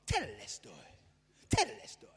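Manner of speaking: a deliberately funky, goofy take on Tetelestoi; self-mocking funky Tetelestoi. Shown as a demonstration. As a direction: jazz up the word Tetelestoi with some funk. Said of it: "Now say it with some funk".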